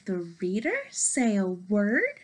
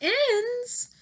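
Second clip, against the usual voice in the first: very high alarmed ends; shocked question; 13 semitones higher, much slower than usual. Acted. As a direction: squeak it in alarm. Gerald shocked by ending.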